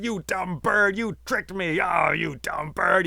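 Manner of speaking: high, loud furious yelling; angry yell. Acted. Man yelling at the bird that fooled him, furious high shout. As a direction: shout it at the bird who tricked you. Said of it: "He yelled all kinds of things".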